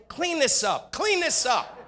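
high, loud mock nagging; nagging wife. Wife ordering the retired president to clean up after the dogs. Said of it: "your wife's gonna say".

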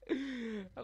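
short high laugh at a slip; high-pitched chuckle at yourself; amused laugh. Real, not acted. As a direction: burst out laughing briefly. Laughs at his own slip.

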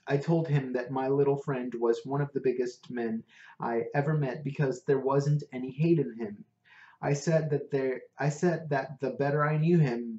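plain reading voice

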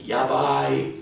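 high, loud and thrilled; excited yabai. Shown as a demonstration. Teacher demos yabai as oh my God, excited. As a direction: blurt it out like a big surprise. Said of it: "the English equivalent of".

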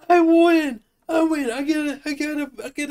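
gleeful victory shout; excited. Real, not acted. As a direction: crow with delight. Gloating over a right answer.

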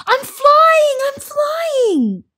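very high, thrilled cry; excited emu voice, high-pitched; exultant squeal. A narrator's reading. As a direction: squeal with joy as you take off.

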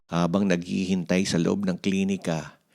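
ordinary delivery, nothing marked

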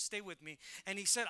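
ordinary delivery

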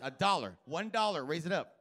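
ordinary talk, nothing marked

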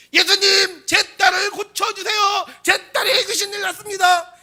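very high, loud shouted plea; demonstrated frantic cry. Shown as a demonstration. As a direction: shout for help at full force, drawing it out. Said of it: "소리만 내지 않고 막 몸을 움직여". (높고 크게 외치는 간청)

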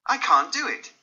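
flat refusal cried out; exclaiming. Acted. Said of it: "he exclaimed".

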